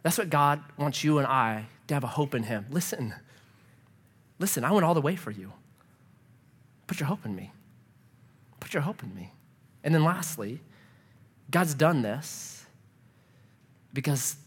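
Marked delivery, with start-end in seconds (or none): 2.7-9.3 soft, low, gentle invitation; tender plea. Acted. Preacher voicing God to the congregation, soft and tender. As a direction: coax them gently to hope. God's voice to listeners.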